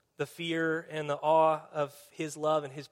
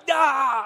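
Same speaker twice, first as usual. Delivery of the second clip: sudden high loud yelp of fright; startled yelp; 8 semitones higher, 10 dB louder than usual. Retold or imitated. Startled when Janine sneaks up behind him, yelping. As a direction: jump out of your skin with a yell.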